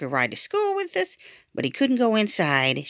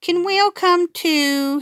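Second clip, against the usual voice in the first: put-on timid little boy asking; 13 semitones higher, 4 dB louder, slower than usual. A narrator's reading. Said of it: "he asked".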